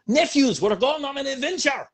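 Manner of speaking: loud, high Scottish cartoon uncle; Uncle Scrooge. Shown as a demonstration. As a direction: call out with an abrasive Scots burr. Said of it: "do Uncle Scrooge".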